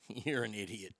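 ordinary delivery

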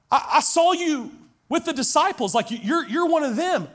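high, pressing accusation; insistent accuser. Acted. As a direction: corner him with it.

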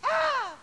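high, very loud imitated wail; mock holler. Preacher imitating daughter at testimony meeting, hollering. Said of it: "she hollered".

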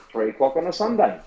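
ordinary talk

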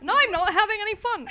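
put-on high, laughing stab at an Australian accent; badly faked Australian son complaining; attempted Australian accent. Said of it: "What's an Australian accent?".

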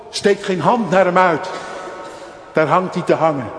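luid en hoog preken, vol vuur; vurige preek. Acted. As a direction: preek het uit met volle overtuiging. Preekt met volle kracht. (vurig preken, luid en hoog)

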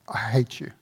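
low, soft hiss through clenched teeth; hissed hate. A narrator's reading. Slave woman hisses hatred at her buyer. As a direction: spit it out quietly through teeth. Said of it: "she hissed through her clenched teeth".

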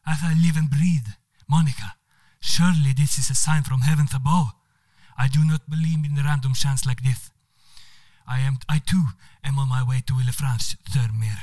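loud, astonished Spanish exclamation; crying out. Acted. Manuel crying out at the coincidence, loud and amazed. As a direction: cry out in amazement with the accent. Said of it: "han skriker ut".

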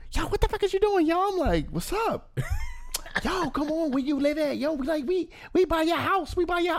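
hammed-up high friend voice; voicing Livy. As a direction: squeak out the friend's impatient call.

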